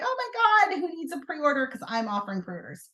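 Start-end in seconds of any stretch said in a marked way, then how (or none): 0.0-1.7 high gushing call-out to customers; mock excitement. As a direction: start high, as if breaking big news. Acts out excited message to customers.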